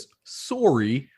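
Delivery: "sorry" drawn out slowly in a Canadian accent, rising at the end; Canadian accent, stretch "sorry" and lift it; Canadian sorry with a rise. Shown as a demonstration. Host coaching the guest in a Canadian accent. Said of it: "you gotta raise it".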